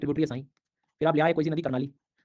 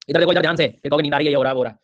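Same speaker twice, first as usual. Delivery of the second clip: louder, calling the class to attention; scolding students; 6 dB louder than usual. Real, not acted. Teacher loudly scolding students who say they feel sleepy. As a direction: wake up the dozing class with a loud scold.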